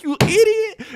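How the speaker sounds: high, loud, laughing "You idiot"; amused imitation of the wife; laughing mock insult. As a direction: laugh through the wife's line. Retelling wife's reaction.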